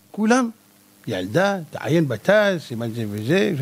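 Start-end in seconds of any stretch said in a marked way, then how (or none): 1.1-3.5 low, quietened disbelieving echo; incredulous rabbi. Acted. Rabbi echoing a child's citation, low incredulity. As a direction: drop the voice and repeat it in disbelief.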